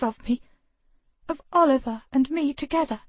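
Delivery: high, pressing accusation; accusing sister. Acted. Grace accuses her twin of jealousy, high and urgent.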